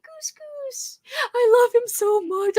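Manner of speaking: high cooing voice; gushing high baby talk to a toy. Retold or imitated. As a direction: coo at the plush mouse. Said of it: "So I'm like".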